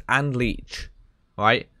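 ordinary delivery

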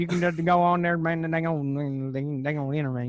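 put-on lazy good ol' boy mumble; Boomhauer impression. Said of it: "Are you Boomhauer from King of the Hill".

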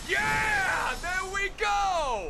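high, slow victory yell; triumphant shout. Real, not acted. Wins the fight.

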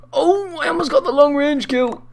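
very high, loud dismayed cry; shrill-pitched, thrilled frustration; excited squeal. Real, not acted. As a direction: squeal over the near miss. Near miss with long shot.

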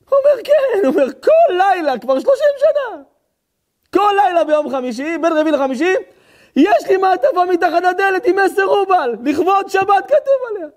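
high, loud, agitated dramatic telling; impassioned storytelling. Acted. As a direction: cry out the tale at full force. (סיפור נרגש בקול רם וגבוה)

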